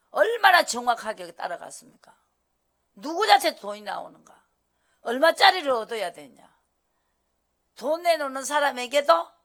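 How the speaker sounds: loud sermon questions hammered out; preaching at full force. Acted. Preacher pressing the congregation, loud and insistent.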